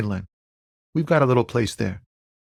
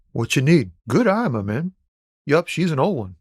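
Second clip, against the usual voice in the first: usual speaking voice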